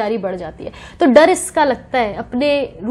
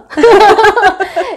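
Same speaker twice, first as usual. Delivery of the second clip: short bright burst of real laughter; amused laugh at the question; 8 semitones higher, 7 dB louder than usual. Real, not acted. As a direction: let a real laugh break out. Laughs at face-reading question.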